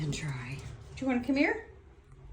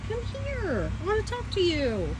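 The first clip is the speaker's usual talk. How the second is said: high pet-talk voice; 9 semitones higher, 5 dB quieter than usual. Real, not acted. Coaxing pet bird to talk.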